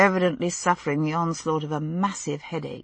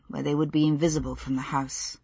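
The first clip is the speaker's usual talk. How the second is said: ordinary delivery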